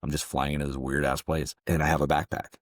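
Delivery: normal talking voice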